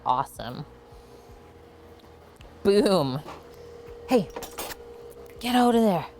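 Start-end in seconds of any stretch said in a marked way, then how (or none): none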